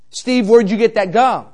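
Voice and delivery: loud, slow, demanding mom; a mother confronting her son, loud and slow; angry mom. Retold or imitated. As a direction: bark the question like an angry mother. Mom angrily questions son.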